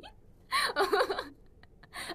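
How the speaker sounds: a high, bright burst of laughter; real giggle at the chat. Real, not acted. Laughing at comments.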